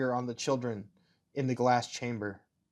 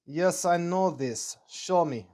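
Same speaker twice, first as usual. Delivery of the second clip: slow, higher put-on German accent; 4 semitones higher, slower than usual. Officer demanding to see the project, slow German accent. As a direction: stretch it out in an amateur German accent. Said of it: "my German accent slips a little into Russian".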